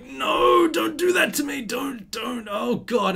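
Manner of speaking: high-pitched, hammed-up wail of despair; panicked shout. Student begging his friend after hearing the answer, panicked shout. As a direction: shriek it in mock agony, then ease off.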